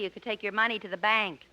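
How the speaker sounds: ordinary talk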